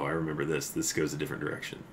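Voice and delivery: ordinary delivery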